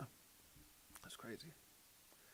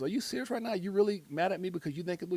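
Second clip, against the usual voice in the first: higher, louder incredulous tiger; mock-indignant tiger, pitched up; tiger in disbelief; 5 semitones higher, 5 dB louder, faster than usual. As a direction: play the tiger raising its voice in protest. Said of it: "and the tiger goes".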